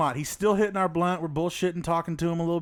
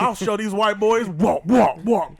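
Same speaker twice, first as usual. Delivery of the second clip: mock shout; 3 semitones higher, 8 dB louder, slower than usual. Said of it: "He was like".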